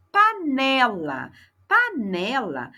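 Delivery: slow, split "Panela" into its three syllables; teacher drawing out "Panela" slowly; slow demo word. Shown as a demonstration. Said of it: "ela é classificada como trissílaba".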